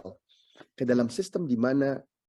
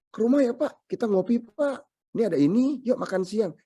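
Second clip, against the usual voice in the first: higher, cheerful inviting tone; friendly invitation; 9 semitones higher, 3 dB louder than usual. Acted. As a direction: warmly call the neighbour over.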